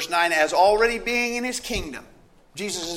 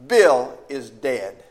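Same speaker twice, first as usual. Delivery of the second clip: grave; 4 semitones lower, 5 dB louder, much slower than usual. Acted. Grim news told slowly.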